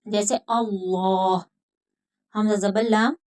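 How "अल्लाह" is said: अल्लाह slow, with a dark rounded "llaw"; heavy lam. Shown as a demonstration. Teacher gives the example, full-mouthed lam in अल्लाह. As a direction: thicken the l of अल्लाह, vowel rounded. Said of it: "मोटा होगा".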